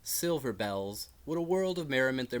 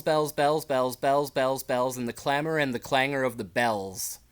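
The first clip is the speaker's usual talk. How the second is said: clanging loud refrain; 2 semitones higher, 8 dB louder than usual. Acted. Reciting the clamor of the alarm bells, loud ringing chant. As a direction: hammer the words like clanging bells.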